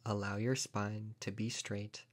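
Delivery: ordinary talk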